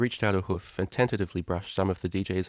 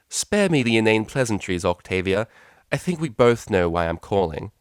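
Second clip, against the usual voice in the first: louder commanding mother voice; cold, imperious and dismissive; mother cutting short the pleasantries; stern mother; 2 semitones higher, 6 dB louder than usual. Acted.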